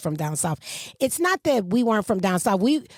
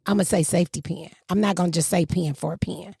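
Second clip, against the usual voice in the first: ordinary delivery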